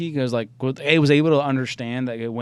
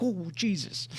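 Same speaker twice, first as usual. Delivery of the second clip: higher, softened startled voice; mock fright; 7 semitones higher, 6 dB quieter, slower than usual. Imitating the dog when owner jumps out, startled. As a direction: act out a small jump of fright. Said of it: "it just gets scared".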